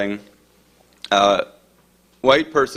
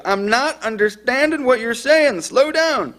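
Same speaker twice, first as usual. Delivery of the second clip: very high, exasperated mock shout; imitated shout; 12 semitones higher, slower than usual. Dispatcher shouts at caller to slow down, imitated shout. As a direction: call it out high, at normal volume. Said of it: "The dispatcher shouts".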